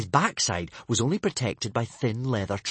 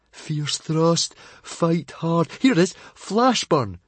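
excited searching; 6 semitones higher, 3 dB louder, slower than usual. Acted. Fishlegs searching the shelf.

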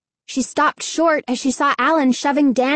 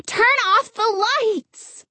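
shouting; 10 semitones higher, slower than usual. Acted. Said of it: "she shouted into them".

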